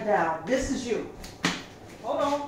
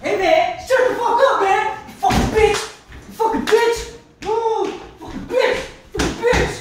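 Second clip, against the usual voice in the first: ranting and raving; 9 semitones higher than usual. Retold or imitated. Retelling boyfriend ranting and cursing at her. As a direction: rail at her, voice up high. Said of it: "ranting and raving".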